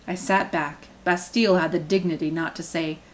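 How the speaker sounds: plain reading voice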